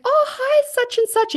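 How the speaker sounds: very high, loud imitation of a delighted hello; mock gushing greeting. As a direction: call out an over-excited hello, mimicking her. Retold loud greeting across a cafe.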